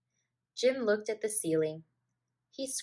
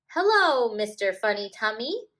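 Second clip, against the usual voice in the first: laughing yell; 2 semitones higher, 8 dB louder than usual. A narrator's reading. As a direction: yell it at your friend while bumping tummies. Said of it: "they laughed and yelled".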